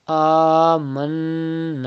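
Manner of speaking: آمنا with the first alif stretched and the doubled noon hummed; slow chant of آمنا with ghunna; recited slowly, held vowels; drawn-out recitation. Shown as a demonstration. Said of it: "Like this".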